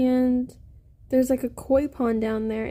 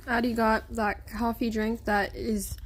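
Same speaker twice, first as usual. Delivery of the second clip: ordinary talk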